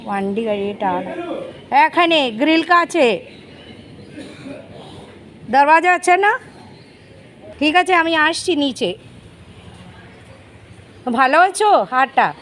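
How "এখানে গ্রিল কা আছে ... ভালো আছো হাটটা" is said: raised voice calling down from upstairs; shouting down to the worker at the gate. Real, not acted. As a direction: call down to the person below, louder and higher. Calling down to someone outside.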